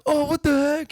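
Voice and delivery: put-on squeaky high shocked kid voice. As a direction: squeal it like a stunned little boy.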